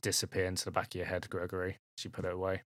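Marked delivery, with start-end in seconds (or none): none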